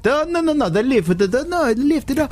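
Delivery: hammed-up Italian chap insisting; mock Italian accent. Italian stranger insists thieves will lift it, mock Italian accent. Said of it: "he was an Italian chap".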